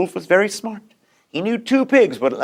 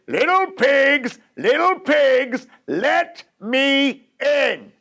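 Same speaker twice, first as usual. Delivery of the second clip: great big voice; 8 semitones higher, much slower than usual. Acted. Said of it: "in a great big voice".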